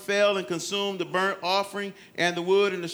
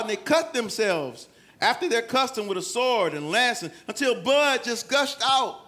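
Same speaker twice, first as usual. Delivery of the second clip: ordinary talk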